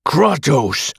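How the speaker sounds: lethal snarl. Acted. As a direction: cut him off with a threat. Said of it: "I snarl lethally".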